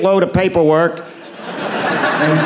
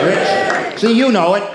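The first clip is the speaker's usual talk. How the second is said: normal talking voice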